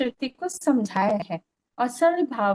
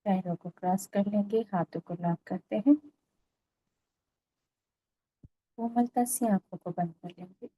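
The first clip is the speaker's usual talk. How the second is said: calm, even meditation voice with long pauses; guided meditation; 2 semitones lower, 3 dB quieter than usual. Real, not acted. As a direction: lead them gently into stillness, phrase by phrase.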